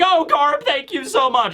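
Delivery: high, gushing mock thanks; tearful gratitude. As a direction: imitate overwhelmed thanks, lightly acted. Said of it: "They're crying. They're like".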